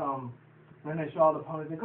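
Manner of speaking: ordinary delivery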